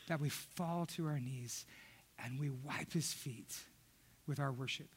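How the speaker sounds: low, very soft reverent delivery; quiet, solemn preacher's hush; hushed preaching. Real, not acted.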